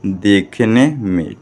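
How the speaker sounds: देखने में stretched out word by word; slow repetition. Shown as a demonstration. Teacher repeats the meaning for pupils, slow and drawn out. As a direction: draw out देखने, then में. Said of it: "क्या हो जाएगा यहाँ पर?".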